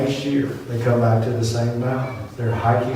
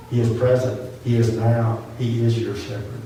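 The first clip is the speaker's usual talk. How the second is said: ordinary talk, nothing marked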